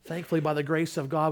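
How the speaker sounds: ordinary talk, nothing marked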